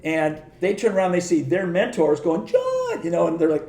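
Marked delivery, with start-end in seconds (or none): 2.4-3.0 high, drawn-out mock cry of welcome; imitating a long, high-pitched hello; imitated delighted greeting. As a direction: hail an old friend way up high. Mentors greeting him, retold.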